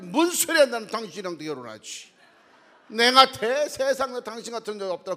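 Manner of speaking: loud, high, laughing assurance; eager husband's reply. Retold or imitated. Husband answering his wife, loud eager yes. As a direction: shout it out with a grin, no hesitation. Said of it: "아내가, "여보, ... 결혼할 거야".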